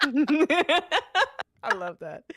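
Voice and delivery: slow, laughing words. Real, not acted. Reacting to Leaf's chat comment, laughing. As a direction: laugh through it.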